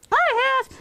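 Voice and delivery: high-pitched little chicken character; puppet chick voice. Acted. Chicken Little chiming in to the storyteller, high puppet voice. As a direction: pipe up eagerly in a small high puppet voice.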